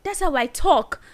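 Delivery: loud, high, defiant sister; imitating the sister's loud snap back; sister talking loud. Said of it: "She's like / starts speaking loud".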